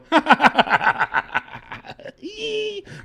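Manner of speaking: long, high staccato fake laugh; demonstrated laugh. Shown as a demonstration. User showing assistant the laugh wanted, exaggerated high cackle. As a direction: rattle off ha-has into a high squeal. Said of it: "I want you to go".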